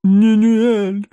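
high, slow, sobbing cry of awe. Acted. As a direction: sob out her name. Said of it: "всхлипнувший Миловеллар".

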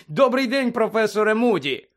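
high, loud hailing greeting; loud call. A narrator's reading. Hermione hailing the professor past Malfoy, calling loudly. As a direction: call out brightly over someone's head. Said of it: "Герміона голосно вигукнула".

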